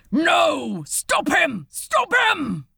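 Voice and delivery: loud, high furious yell of alarm; furious shout. Acted. Guard shouting from above to stop the leaping demon, furious. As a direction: bellow it to stop him. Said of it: "There was a furious".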